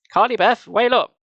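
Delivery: high, loud call from another room. Acted. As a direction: call her from the kitchen. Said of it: "Missus Caldwell called from the kitchen".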